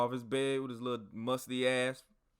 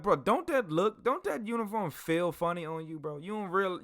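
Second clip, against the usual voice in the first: higher-pitched, disbelieving challenge; retold incredulous questioning; mock incredulity; 8 semitones higher, 4 dB louder than usual. Soldier questions a comrade in someone else's uniform, mock incredulous.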